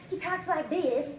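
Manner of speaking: slow, lightly put-on mock Tyson voice; a hint of a slow boxer's drawl, imitating; Tyson impression. Coach mocking Tyson's speech to his team, lightly slowed. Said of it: "Look at Tyson".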